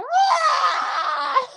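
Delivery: a sudden, very high mock shriek; a child's frightened yell; imitating a kid's scream. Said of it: "just flat out just screamed".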